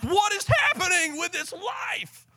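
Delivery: high-pitched mock shout of exasperation; hammed-up wail, pitched very high; worked up. Preacher acting out a stressed household, exasperated high shout. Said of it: "You've got a little worked up".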